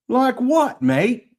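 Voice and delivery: high, loud Cockney try that drifts Australian; a British pyromancer asking his party what the plan is, in a Cockney accent. Acted. A Cockney pyromancer's loud, high question. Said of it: "It's real close to Australian".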